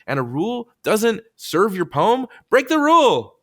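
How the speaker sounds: loud, high rallying cry; rousing exhortation. Real, not acted. Host urges poets to rebel, rousing shout.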